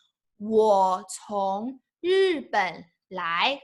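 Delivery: slow model phrase. Shown as a demonstration. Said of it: "teacher models the phrase slowly".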